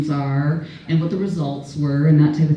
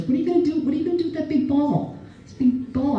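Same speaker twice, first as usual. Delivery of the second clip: higher, puzzled mom voice; imitating mom; 10 semitones higher, faster than usual. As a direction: play the baffled mother questioning. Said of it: "Mom's just like".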